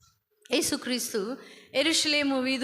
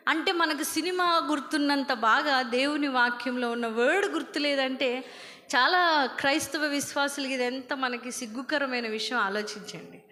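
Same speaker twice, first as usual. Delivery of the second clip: ordinary talk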